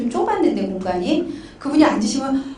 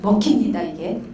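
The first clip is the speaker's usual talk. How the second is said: ordinary talk, nothing marked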